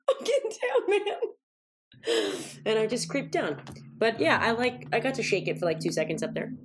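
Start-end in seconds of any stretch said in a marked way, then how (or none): 0.1-2.3 high squeaky voice. Retold or imitated. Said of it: "And I was like".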